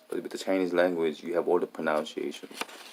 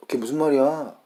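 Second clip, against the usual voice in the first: high, louder native Korean tune; exact Korean rhythm; 9 semitones higher, 7 dB louder, much faster than usual. Shown as a demonstration. Speaker showing the phrase as picked up, high Korean intonation. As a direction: say it with Korean lilt, pitched up. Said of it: "the rhythm".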